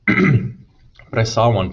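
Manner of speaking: slow and firm, dig in your heels; insisting. A narrator's reading. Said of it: "Peter insisted".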